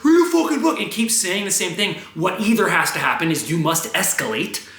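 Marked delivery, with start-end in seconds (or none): 0.0-0.8 loud, high-pitched heckler shout; high, loud mock yell; mimicked yell. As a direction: parrot the angry stranger's holler. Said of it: "you can't then go".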